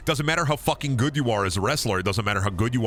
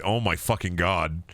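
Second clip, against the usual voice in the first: normal talking voice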